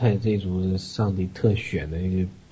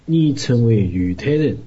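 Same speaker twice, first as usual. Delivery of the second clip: slow, louder recitation in Zhejiang dialect; 6 dB louder, slower than usual. Shown as a demonstration. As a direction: recite it in stagy Zhejiang speech. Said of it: "用浙江话念".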